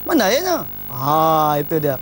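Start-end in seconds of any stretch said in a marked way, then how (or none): 0.0-0.6 higher, curt husband snapping for his drink; mock irritated where's-my-drink; impatient demand. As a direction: demand it like a husband whose love has cooled. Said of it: "tapi intonasi dah berubah".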